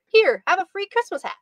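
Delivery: cheery salesman pitch. Acted. Elf man offers hat cheerily.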